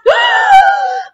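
huge, high theatrical gasp; crowd's gasp. A narrator's reading. Narrator acting everyone's reaction to flying sculpture, aghast. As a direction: gasp loudly in mock horror. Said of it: "Everyone gasped".

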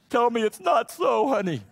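high, loud mock plea to his wife; pleading for sympathy. Husband turning to his wife for compassion, loud mock pleading. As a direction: beg her to take his side, half in jest. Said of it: "looking for compassion".

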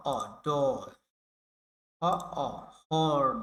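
slow, stretched অ before ডল and হর্ন; drawn-out vowel. Shown as a demonstration. Teacher stretches the অ sound for learners, very slow. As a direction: hold the অ long, then say হর্ন. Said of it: "কিন্তু এখানে হচ্ছে অ".

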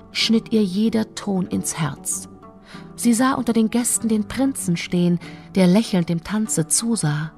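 plain reading voice

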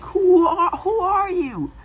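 very high, near-crying question; high teary mock panic; whimpering panic. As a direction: beg in terror. Richard still panicking.

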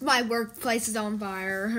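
low, offhand report; unbothered. Pretend. Retelling the workplace fire, low and unbothered. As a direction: shrug off the news in a low voice. Same news shrugged off, not very.

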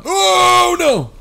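high, loud, drawn-out wail; alarmed yell. Real, not acted. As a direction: cry out long and high in dismay. Mishap in the game.

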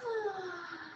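soft high sleepy sigh. Acted. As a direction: breathe out the day's tiredness. End of the story.

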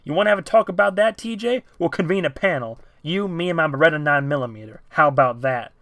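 guard sneering threat with a gun; angry threat. Acted. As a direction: taunt him into backing down. Owen threatens TJ with his gun.

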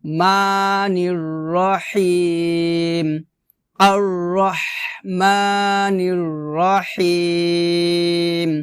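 slow, drawn-out tajweed recitation; measured chanting for students to repeat; Quran recitation demo. Shown as a demonstration. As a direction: recite slowly, holding the long vowels. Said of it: "মা এক লিফ টান হবে".

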